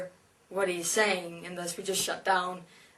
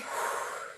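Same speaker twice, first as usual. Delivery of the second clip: a slow, audible breath let out; an unvoiced release of breath; demonstrated sigh; 5 dB quieter than usual. Shown as a demonstration. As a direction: exhale and let it all go. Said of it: "You breathe".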